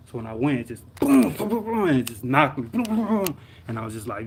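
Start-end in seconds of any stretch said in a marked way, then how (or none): 0.9-1.9 rolling burst of high impact noises; imitated crash booms. Driver retelling the hit, rumbling boom effects. Imitating the car being knocked around. 2.7-3.4 rolling burst of high impact noises; imitated crash booms. Driver retelling the hit, rumbling boom effects. Imitating the car being knocked around.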